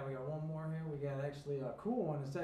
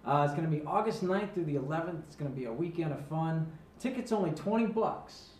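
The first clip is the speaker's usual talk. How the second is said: radio ad read; 9 dB louder than usual. Acted. Radio promo for [name] show.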